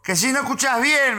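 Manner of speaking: heated, high-pitched retold challenge; exasperated repeat. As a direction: repeat it harder for someone hard of hearing. Stranger repeats it for poor hearing.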